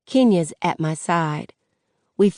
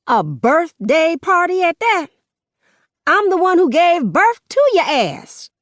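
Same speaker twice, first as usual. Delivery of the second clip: much higher, loud outraged mother's voice; furious mom, voice pitched up and loud; angry mother; 12 semitones higher, 5 dB louder than usual. Acted. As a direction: rant at him like the mother who raised him. Mother confronting son at party.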